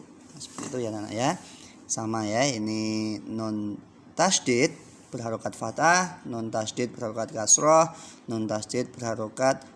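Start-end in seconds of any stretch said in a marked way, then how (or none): none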